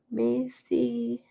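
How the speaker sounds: ordinary delivery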